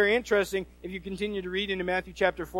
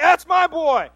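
high, loud proud-parent shout; cheering parent; 9 semitones higher, 7 dB louder, slower than usual. Acted. Parent cheers a kid's hit at baseball, proud loud shout. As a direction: yell it from the bleachers. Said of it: "when you're at your kid's baseball game".

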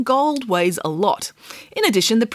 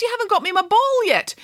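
princess voice; 14 semitones higher than usual. A narrator's reading. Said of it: "said the princess".

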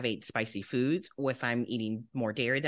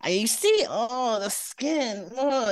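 lightly mocked French accent, pitched up; a hint of French facialist, higher voice; [name]'s French accent; 8 semitones higher, much slower than usual. Facialist lamenting client's skin, mild high French accent. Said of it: "she's got a French accent".

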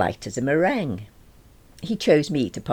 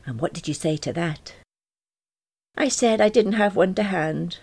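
higher, matter-of-fact character voice; lightly voiced, earnest oddball; earnest Peter; 5 semitones higher than usual. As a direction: report the absurd in all seriousness. Voices Peter recounting talk with giraffe.

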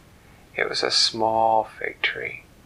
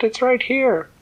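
high, louder, laughing fairy voice; bright fairy; 13 semitones higher, 6 dB louder than usual. Acted. The fairy pats the trunk and cheerfully answers the tree. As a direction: chirp it out, pleased with herself.

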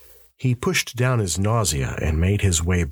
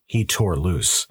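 plain reading voice

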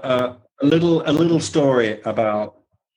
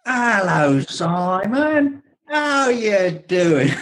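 imitated Cockney greeting, higher and slow; a light Cockney accent, drawn out; 6 semitones higher, much slower than usual. Tony greets Simon in a mock Cockney accent. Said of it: "talking in an English Cockney accent".

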